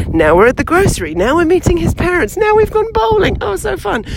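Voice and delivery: high, swooning rom-com glee; mock gushing. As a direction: gush like a giddy couple in a montage.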